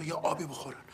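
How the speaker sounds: ordinary talk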